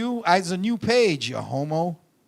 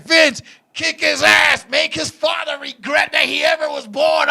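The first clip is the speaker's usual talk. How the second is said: woman egging on; 8 semitones higher, 6 dB louder than usual. Retold or imitated. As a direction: imitate a merciless fan screaming orders. Imitating woman egging Vince on.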